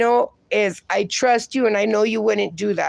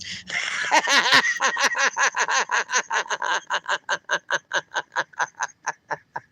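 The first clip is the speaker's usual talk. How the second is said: long rattling belly laugh, trailing off; real hearty laugh; 4 dB quieter than usual. Real, not acted. Host cracking up at the tariffs joke, long helpless laugh. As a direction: laugh on until out of air.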